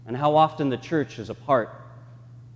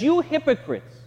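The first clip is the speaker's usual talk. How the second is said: rebuking Jesus; 12 semitones higher than usual. Retold or imitated.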